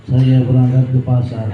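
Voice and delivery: loud, flat sleep-talker's mumble; mock sleep-talking. Teacher mimics a student talking in his sleep. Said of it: "Ngigo. Lagi tidur".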